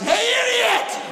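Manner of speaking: very high, shouting insult like a blaring horn; rude car horn voice. Acted. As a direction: bark it out as the angry horn. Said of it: "all that comes out is".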